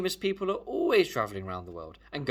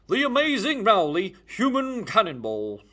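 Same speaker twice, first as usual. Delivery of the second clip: loud high ringmaster call, slowing on cannonball; circus announcer; 10 semitones higher, 9 dB louder, slower than usual. Acted. Ringmaster introducing Rowley the human cannonball, loud and high. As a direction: boom it out like a ringmaster.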